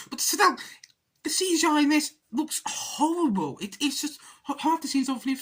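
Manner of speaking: boil over about the awful film; angry rant. Real, not acted. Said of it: "Apologies for my, um, little outburst".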